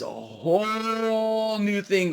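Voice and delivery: "whole" held very long on one note; drawn-out emphasis. Real, not acted. Commentator announcing a new system to viewers, dragging out "whole". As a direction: stretch the vowel of "whole" right out, then move on.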